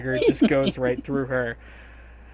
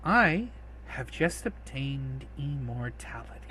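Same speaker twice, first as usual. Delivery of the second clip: soft, whispery villainess; hushed, theatrical gloat; gloating whisper; 8 dB quieter than usual. Acted. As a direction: whisper it as a villain savoring triumph. Villain gloats, voiced by game master.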